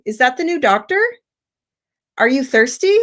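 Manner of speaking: yes-no questions lifting on "doctor" and "thirsty"; rising question intonation. Shown as a demonstration. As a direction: end each question on a climb. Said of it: "So the rising".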